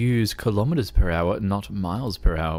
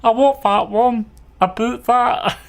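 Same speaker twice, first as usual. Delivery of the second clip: bad British accent, much higher and louder; Little Britain British accent; 13 semitones higher, 6 dB louder than usual. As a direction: ham up a British comedy-sketch accent. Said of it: "sounds like Little Britain".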